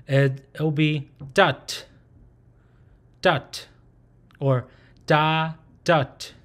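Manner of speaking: "dot" with a crisp final t, slow and spaced; closed syllable dot. Shown as a demonstration. Teacher demonstrating the closed syllable "dot" for students. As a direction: land the t in "dot" cleanly. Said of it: "Now for the closed syllable".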